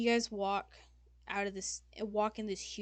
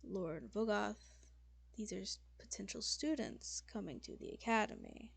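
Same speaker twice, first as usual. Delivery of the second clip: weary resignation; 4 dB quieter than usual. Acted. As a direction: reason patiently after a sigh. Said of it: "Lord Mazaroth sighs".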